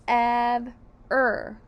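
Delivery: "Ev-er" loud, in two held beats; syllable by syllable. Shown as a demonstration. Teacher joins the first syllables, stretched and separated. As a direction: hold each part of "Ev-er" separately.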